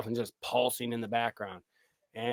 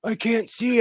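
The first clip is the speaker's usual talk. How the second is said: mock frustrated shout; 11 semitones higher than usual. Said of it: "and I'm like".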